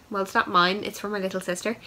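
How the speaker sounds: ordinary delivery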